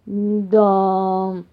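long, low drawn-out yes; drawled. A narrator's reading. Papa bear reflecting on the fox, drawling. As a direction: stretch the word out thoughtfully. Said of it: "протянул папаша Бамсе".

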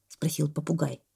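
plain reading voice

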